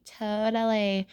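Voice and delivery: slow, drawn-out mock reply. Sisters laughing away from Molly, imitated mockingly. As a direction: imitate someone mockingly, stretching the word. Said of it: "so mockingly, they laugh away and say".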